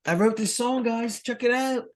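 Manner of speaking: put-on high-pitched gushing over a kid's effort; mock childish excitement. Bandmates humouring the drummer's song, mock gushing. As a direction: coo it very high like an indulgent parent. Mocking childish songwriter and doting parents.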